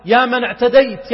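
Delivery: ordinary delivery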